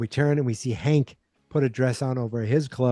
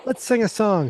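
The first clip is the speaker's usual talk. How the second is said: high mock-cheery hostess; sing-song it high to smooth things over; covering up cheerily; 9 semitones higher than usual. Peggy covering up at the Christmas party, cheerily. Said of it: "She just wants to cover up".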